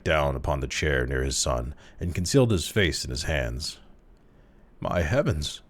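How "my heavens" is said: usual speaking voice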